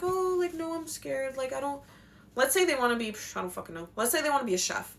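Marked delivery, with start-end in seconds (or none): 0.0-1.8 put-on scared child. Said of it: "they're like".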